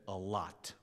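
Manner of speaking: low, soft, grave answer; quiet resolve. Retold or imitated. Angel answering the gang member in church, quiet resolve. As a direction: drop right down and say it quietly.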